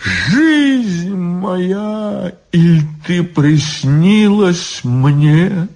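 high, drawn-out anguished lament; suffering voice. A narrator's reading. Drunk tearful guard lamenting his life, suffering voice. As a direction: wail it poetically, stretching the words. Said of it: "сказал он страдальческим голосом".

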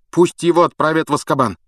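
high, loud, firm insistence; insistent boy. A narrator's reading. Harry insisting on his verdict. (высокий настойчивый голос)

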